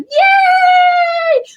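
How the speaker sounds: high, loud gleeful yay; a shrieked yay of joy; excited cheer. Retold or imitated. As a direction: cheer it like a kid. Cheering news he is cancer free.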